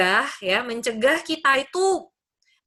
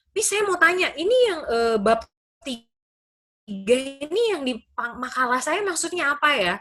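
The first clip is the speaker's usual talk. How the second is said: lightly voiced student, raised pitch; imitating student; 4 semitones higher than usual. Student messaging the lecturer about coursework, imitating student. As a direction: act out a student's eager question. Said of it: "chat saya gitu ya, mahasiswa".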